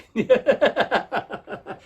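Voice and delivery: high staccato vampire laugh; loud laugh that trails away; Dracula cackle. Acted. Dracula flies off to a party, high mock-evil cackle.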